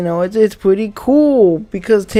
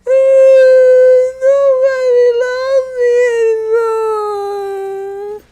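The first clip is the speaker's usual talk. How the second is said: very high, slow wailing cry; hammed-up blubbering over lost followers; mock sobbing; 14 semitones higher, much slower than usual. Depressed TikTok kid wailing about followers, mock sobbing. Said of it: "crying their asses off".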